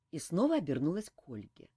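ordinary delivery

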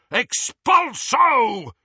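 high, drawn-out bellowed spell; bellowing. Acted. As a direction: bellow it with full force. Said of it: "bellowed the Death Eater".

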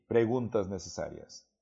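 ordinary talk

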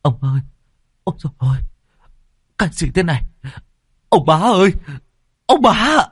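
rising into high, loud alarmed cries; shouting in shock. A narrator's reading. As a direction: yell out as you find him on the floor. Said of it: "mà hét lên". (hét lên hốt hoảng, cao và to dần)

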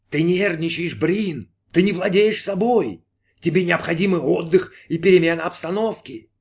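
high, insistent voice in the head; nagging inner voice. Acted. Voice in Brin's head urges rest, high and nagging.

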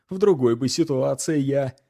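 ordinary delivery

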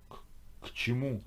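stuttered, halting question; stammering. A narrator's reading. Trembling Sergey squeezes out a question to Ho, stammering. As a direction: catch on the first consonant, then force it out. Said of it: "заикаясь, выдавил из себя Сергей".

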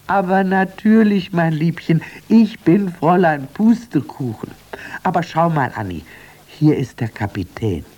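high, loud tender reassurance. Acted. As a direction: comfort the child lovingly. Sister tenderly reassures Annie.

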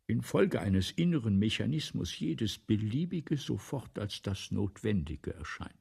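ordinary talk

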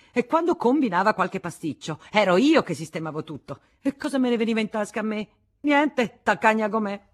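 loud, high-pitched resentful rant; tumultuous anger. A narrator's reading. Woman complaining of her thankless rival, raging. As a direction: pour out the grievance in a torrent. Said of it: "la sua collera fece passare tumultuosamente".

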